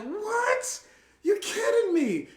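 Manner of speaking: way up high, stunned and delighted; shocked disbelief. Retold or imitated. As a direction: squeak out total disbelief. Stunned by girls' compliment.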